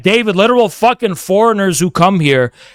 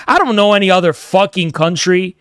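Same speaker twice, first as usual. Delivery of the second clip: usual speaking voice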